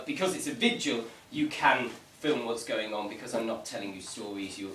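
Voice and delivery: ordinary delivery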